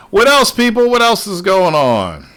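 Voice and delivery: loud, high call to the audience. Real, not acted. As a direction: holler it out to the room, drawing out the end. Calling out to chat for topics.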